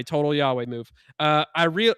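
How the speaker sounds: ordinary delivery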